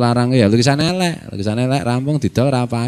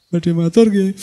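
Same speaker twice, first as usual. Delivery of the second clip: high, timid student asking to speak; a young santri begging to speak; eager student voice; 10 semitones higher, slower than usual. Acted. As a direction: pipe up like a shy santri asking leave.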